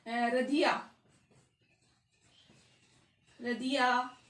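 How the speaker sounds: low-pitched call of रधिया with a long आ; drawn-out calling. Shown as a demonstration. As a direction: hold the last vowel of रधिया. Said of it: "किसी को पुकारते हैं".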